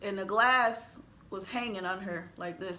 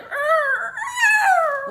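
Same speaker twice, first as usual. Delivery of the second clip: high straining groans; desperate grunting cry; strained high cry; 7 dB louder than usual. Retold or imitated. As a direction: yell as you wrestle the wheel. Dad fighting the wheel.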